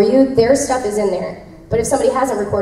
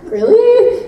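high, slow disbelieving really; incredulous; 10 semitones higher, slower than usual. Real, not acted. Actress doubting the script line, high and disbelieving. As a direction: stretch it out in disbelief. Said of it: "incredulous at the script".

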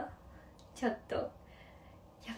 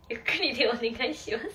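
laughing; 4 dB louder than usual. Real, not acted. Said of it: "早口は早口で面白かった".

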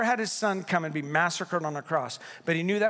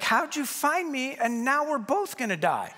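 dismayed hostage; 7 semitones higher than usual. Retold or imitated. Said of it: "You'd probably be like".

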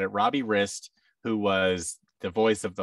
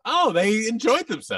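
bright, raised, delighted surprise; pleased surprise; 14 semitones higher, 7 dB louder than usual. Retold or imitated. Producer watching actors' interviews, pleasantly surprised. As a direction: react with a pleased gasp of surprise. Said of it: "And I'm like".

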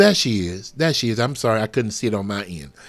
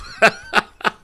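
high airy burst of laughter; high, loud breathy church laugh; breathless laugh; 13 semitones higher, 6 dB louder than usual. Real, not acted. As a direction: laugh out, carried away.

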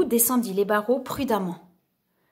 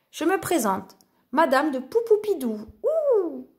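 higher, coquettish lady ending on a high "Ouh"; theatrical masked lady; lady introducing herself; 5 semitones higher than usual. A narrator's reading. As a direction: introduce yourself with a playful flourish. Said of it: "dit-elle en soulevant son masque".

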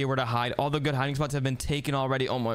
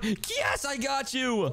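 high-pitched victory cry; triumphant; 8 semitones higher, much slower than usual. Real, not acted. Seeker catching brother after three, two, one, triumphant. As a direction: pounce with a high, excited yell.

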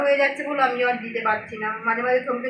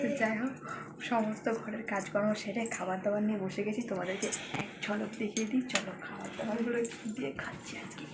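much softer near-whisper; 3 semitones lower, 14 dB quieter than usual. Real, not acted. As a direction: keep it very soft, the daughter is asleep. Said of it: "খুব আস্তে কথা বলছি".

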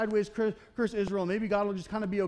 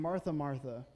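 calm and loving; 5 semitones lower, 5 dB quieter than usual. Retold or imitated. Jesus gently rebuking Martha, calm and loving. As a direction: correct her tenderly, no edge. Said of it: "super calmly looking at her with all this love".

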